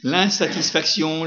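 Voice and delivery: normal talking voice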